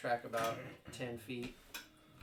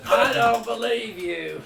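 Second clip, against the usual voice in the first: loud disbelief; 10 semitones higher, 15 dB louder, slower than usual. Real, not acted. DM reacts to roll, laughing.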